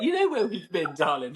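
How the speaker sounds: high, gentle sing-song mother; doting mum voice. Retold or imitated. Mother answering her sassy daughter, high sing-song with a laugh. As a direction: reassure her like a sweet mum. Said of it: "I was like".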